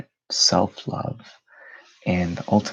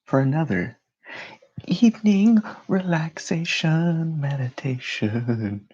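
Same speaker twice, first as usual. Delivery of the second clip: higher, drawn-out show opener; lively intro; 7 semitones higher than usual. Real, not acted. Host opening an evening relaxation meditation livestream, lively announcer voice. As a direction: announce it like a live show, stretching the last words. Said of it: "trying to make it lively".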